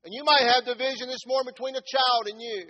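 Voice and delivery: loud, high, fast preacher's cry; fiery preaching. Acted. Pastor preaching about neglected children, shouting fervently. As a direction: preach it out full force to the pews.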